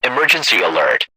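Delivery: usual speaking voice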